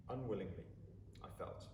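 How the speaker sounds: usual speaking voice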